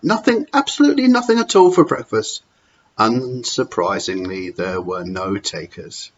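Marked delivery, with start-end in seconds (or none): 0.0-2.4 high, bright sing-song call to the ward; cheerful call. A narrator's reading. Dinner lady calling to George, cheerfully high. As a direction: sing it out like good news. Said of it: "cheerfully calling out".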